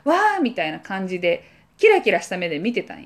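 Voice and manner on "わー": high, wide-eyed little "wah"; kids' awed wah; excited kids' gasp. Retold or imitated. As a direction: gasp in awe like a kid. Kids gasping in awe.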